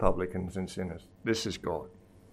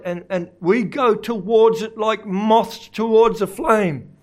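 higher, swelling sermon delivery; impassioned preaching; 9 semitones higher, 6 dB louder than usual. Real, not acted. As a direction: preach it with rising fervour. Preacher rises to full force.

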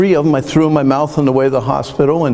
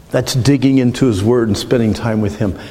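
ordinary talk

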